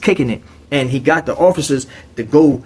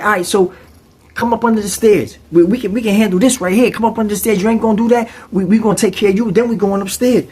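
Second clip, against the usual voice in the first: higher, quick and coaxing; lightly voiced inmate; 7 semitones higher, faster than usual. Inmate coaxing the roommate under the stairs, lightly voiced. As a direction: talk him into it.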